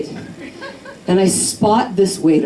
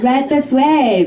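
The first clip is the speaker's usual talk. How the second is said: higher, slow, syrupy mock hostess; 7 semitones higher, slower than usual. Mimicking the hot hostess leading her to a table, high and slow. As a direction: sing it out like a smug hostess.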